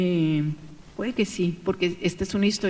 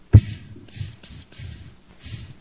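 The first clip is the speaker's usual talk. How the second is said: unvoiced little psst sounds; mouse psst; much slower than usual. Ratón Pérez wooing the cockroach with a whispered psst. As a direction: call her with a hushed mouse psst. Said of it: "tu voz no me da miedo".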